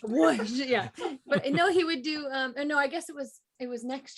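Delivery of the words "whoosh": loud playful whoosh; mock whoosh effect, louder; imitating a toddler's whoosh. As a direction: belt out a kid's whoosh sound. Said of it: "He quoted it by going".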